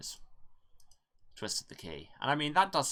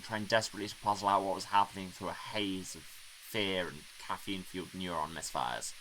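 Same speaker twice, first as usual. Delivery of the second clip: usual speaking voice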